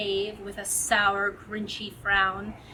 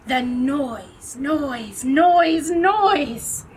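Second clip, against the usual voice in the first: building to shout; 3 semitones higher, 7 dB louder, much slower than usual. A narrator's reading. Narrator mimicking the Grinch's hatred of noise, rising to shout. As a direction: build up till you explode.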